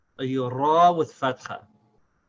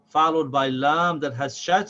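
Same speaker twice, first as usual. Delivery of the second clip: normal talking voice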